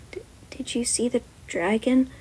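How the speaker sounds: hushed unvoiced whisper. A narrator's reading. Student whispering about the dragon to classmates, hushed whisper. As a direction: whisper it like a secret.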